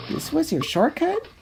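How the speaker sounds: high, fast squeaky sarcastic line; squeaky cartoon voice. Mary Jane mocking the failed shortcut, squeaky and sarcastic. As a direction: squeak it with disbelief. Character line in read-out script.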